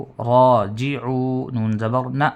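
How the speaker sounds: drawn-out راجعون built from syllables; teacher's slow drill; slow spelling drill. Shown as a demonstration. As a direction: spell it slowly then say it whole. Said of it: "یہ اس طریقے سے ہے".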